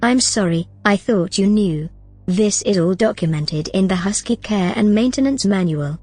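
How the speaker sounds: ordinary talk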